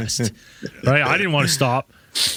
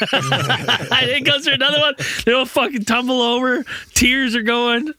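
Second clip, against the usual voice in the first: words breaking into giggles, pitched up; can barely get it out for laughing; laughing through it; 10 semitones higher than usual. Real, not acted. Laughing while telling the tumble.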